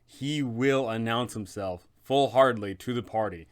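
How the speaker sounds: ordinary delivery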